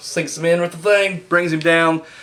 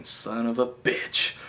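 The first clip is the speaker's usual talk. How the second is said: muttered curse; 4 semitones lower, 10 dB quieter, much slower than usual. Retold or imitated. His reaction to the heel turn.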